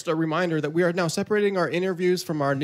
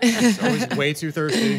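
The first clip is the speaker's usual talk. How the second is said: laughing; 7 semitones higher, 5 dB louder than usual. Real, not acted. Joking, laughing about being thirsty.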